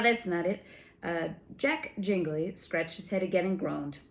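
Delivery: ordinary delivery, nothing marked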